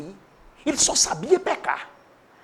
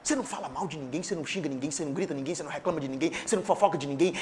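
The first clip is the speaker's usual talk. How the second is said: lower, much softer, fast run; quiet preaching; 7 semitones lower, 10 dB quieter, much faster than usual. Real, not acted. Preacher listing sins softly to the church. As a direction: drop the voice and list it quickly.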